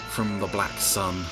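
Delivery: ordinary talk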